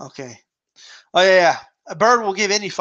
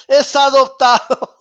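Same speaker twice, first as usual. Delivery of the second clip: higher, louder meme delivery; loud mock quote; 5 semitones higher, 6 dB louder than usual. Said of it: "Chavez Senior".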